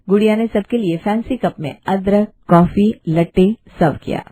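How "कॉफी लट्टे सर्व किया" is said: plain reading voice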